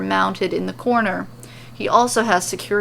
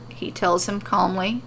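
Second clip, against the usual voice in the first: ordinary delivery, nothing marked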